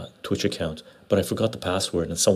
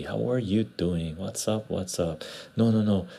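ordinary talk

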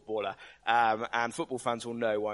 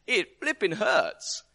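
laughing; 8 semitones higher, 7 dB louder, slower than usual. Real, not acted. Laughing about childbirth pain.

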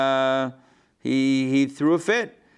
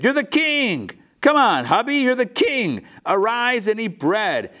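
much higher, sing-song cajoling; coaxing wife; 8 semitones higher than usual. Acted. Izebel to her pouting husband, high cajoling tease. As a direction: coax him out of his sulk, playfully.

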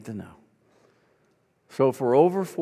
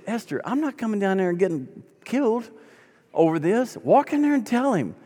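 higher, retold indignant refusal; exasperated instructing; 8 semitones higher than usual. Young pastor refusing to intervene, exasperated. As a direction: push back firmly, re-enacting the phone call.

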